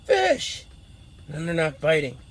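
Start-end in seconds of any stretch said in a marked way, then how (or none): 0.0-0.7 higher excited yelp of the word; showing the thrill of a bite. Shown as a demonstration. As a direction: burst out as a bite comes. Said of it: "First, you're excited".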